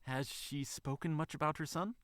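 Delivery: soft, high, rising question; meek. Acted. Bunny asking the manager about the son, meekly. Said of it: "Judy asked meekly".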